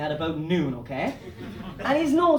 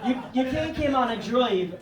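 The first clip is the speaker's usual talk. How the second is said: usual speaking voice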